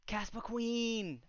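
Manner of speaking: slow, high, stretched-out greeting; drawn-out excited welcome. Real, not acted. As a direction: call her name out with delight.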